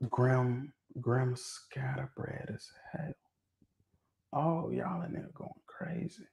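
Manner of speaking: very soft, near-whispered disbelief; slow it right down, almost whispering in pretend scandal; hushed mock shock. Host reacts to chat calling Grimm scatterbred, hushed mock shock.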